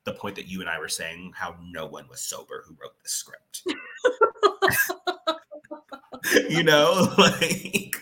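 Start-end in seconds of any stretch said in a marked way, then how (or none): 3.7-7.9 loud, high words through laughter; real laughter. Real, not acted. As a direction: still cracking up while talking. Laughing at own joke about script.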